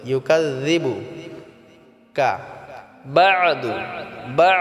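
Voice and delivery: Quran recitation. Shown as a demonstration. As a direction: recite slowly with long held notes. Recites word. (কুরআন তিলাওয়াতের সুরে ধীরে পড়া)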